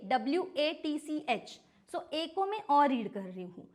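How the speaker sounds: ordinary talk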